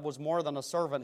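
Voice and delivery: ordinary delivery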